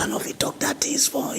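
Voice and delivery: hushed, high whisper; secretive whisper. Acted. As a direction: whisper it as if not allowed to say it. Pretends to speak in secret.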